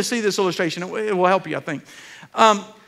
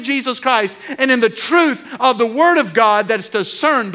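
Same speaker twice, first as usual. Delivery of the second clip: fervent prayer; 4 semitones higher than usual. Real, not acted. Pastor praying aloud for Grace Fellowship, fervent and raised. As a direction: pray over the church in full voice.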